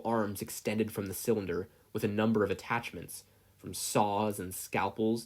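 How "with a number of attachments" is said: usual speaking voice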